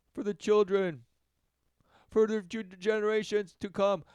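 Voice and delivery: high sing-song mock earnest activist. Mocking environmental activists.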